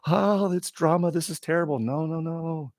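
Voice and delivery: high-pitched mocking wail of dismay; whiny, put-on dismay; mock hand-wringing. Mocking people who dislike drama.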